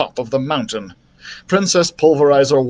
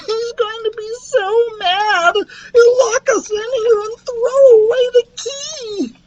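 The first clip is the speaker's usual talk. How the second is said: very high-pitched, slow tearful wail; sobbing. A narrator's reading. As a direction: squeal it through exaggerated sobs. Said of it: "Lucas sobbed".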